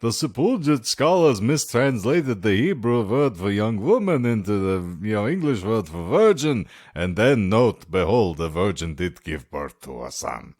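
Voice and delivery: thick put-on foreign accent, swooping intonation; Snatch movie accent. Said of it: "the opening sequence of Snatch".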